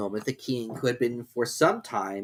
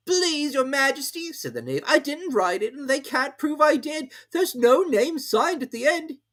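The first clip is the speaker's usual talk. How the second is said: knave voice; 4 dB louder than usual. A narrator's reading. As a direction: plead innocence as the knave. The knave pleads.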